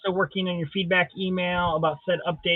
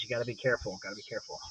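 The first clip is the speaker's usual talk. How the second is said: softer, lower echo dying away; fading repeat; 8 semitones lower, 12 dB quieter, much faster than usual. Shown as a demonstration. Host repeats the warning, fading to nothing. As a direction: let it fade to a mutter. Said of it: "repeated, fading".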